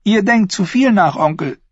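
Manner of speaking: annoyed. Acted. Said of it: "Thyene wurde ärgerlich".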